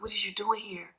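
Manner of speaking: hushed, breathy whisper of disbelief; retold whisper. To the ex at her door, a stunned whisper. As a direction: whisper it like you can't believe he's there.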